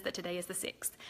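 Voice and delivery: quick hushed aside, relaying a whisper from the back. Real, not acted. Host passing on the date from helpers in back, quick whisper. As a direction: whisper it fast, like passing on a note.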